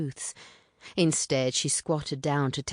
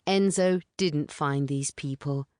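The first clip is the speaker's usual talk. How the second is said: plain reading voice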